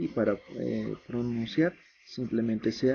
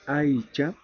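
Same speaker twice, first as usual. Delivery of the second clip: clear, measured drill of Aymara vocabulary; pronunciation drill; 3 semitones higher, slower than usual. Shown as a demonstration. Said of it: "vamos a repasar lo que es la pronunciación". (repaso de pronunciación, en voz alta)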